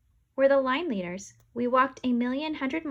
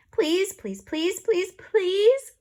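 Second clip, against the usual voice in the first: higher and louder pleading; begging; 10 semitones higher, 7 dB louder, much slower than usual. A narrator's reading. Kids begging the lady to buy, high and loud. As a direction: beg with rising pleas. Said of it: "we all begged".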